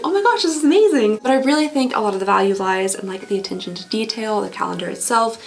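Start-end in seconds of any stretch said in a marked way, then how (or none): 0.0-1.2 high, bright gush of delight; gushing excitement. Retold or imitated. Imitating her own delight over the calendar, high and excited. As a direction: squeal over a treasure. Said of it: "I'm like".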